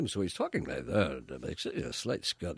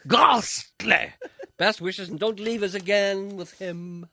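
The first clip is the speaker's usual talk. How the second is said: high, loud, slow posh exclamation; Prince Philip; 10 semitones higher, 9 dB louder, much slower than usual. Retold or imitated. Prince Philip giving his view of the stand-in show, louder and slower. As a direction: sneer it like an old royal. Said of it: "His reply says".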